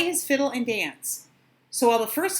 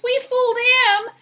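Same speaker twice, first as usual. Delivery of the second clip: gleeful; 13 semitones higher, 7 dB louder than usual. Acted. Pigs gloat.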